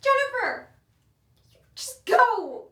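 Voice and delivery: slow, loud, high angry retort. Acted. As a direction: spit each word out.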